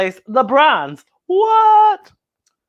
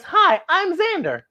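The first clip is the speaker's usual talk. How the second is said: Xander voice; 10 semitones higher than usual. Acted. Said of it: "And Xander says".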